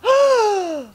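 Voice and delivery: sharp high gasp of fright; startled gasp. Acted. Owl startled by the gruffalo, loud gasp. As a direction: jump and gasp.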